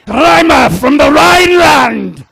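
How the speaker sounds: booming host intro, rising to a shout; announcer shout. Real, not acted. Host to crowd, building to a loud announcer shout. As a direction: hype up the next comic.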